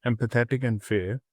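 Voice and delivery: usual speaking voice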